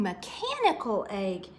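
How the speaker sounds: ordinary delivery, nothing marked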